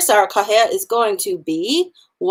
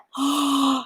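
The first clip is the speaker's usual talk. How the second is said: mock gasp for a reveal; dramatic gasp; 7 semitones higher, 4 dB quieter than usual. Teacher gasping at the twin sister reveal, hammed up. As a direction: gasp like a soap opera.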